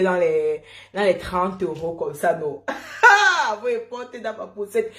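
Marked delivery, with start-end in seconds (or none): 2.7-3.6 laugh. Real, not acted. Laughing at her own story.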